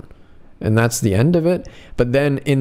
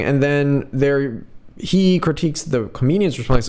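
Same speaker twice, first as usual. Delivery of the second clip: usual speaking voice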